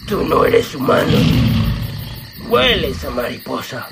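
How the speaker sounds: breathy, voiceless tiger snarl; a big cat sniffing its prey; growling tiger voice. Acted. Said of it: "dijo el tigre". (voz de tigre gruñona)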